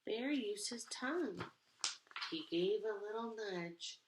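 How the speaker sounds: put-on lively read-aloud to kids; expressive picture book narration; storybook reading. A narrator's reading. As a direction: read it like storytime for little ones.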